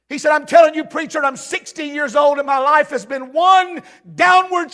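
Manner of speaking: urgent pulpit storytelling; impassioned retelling. Retold or imitated. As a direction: pile up the losses with mounting force. Preaches the man's story.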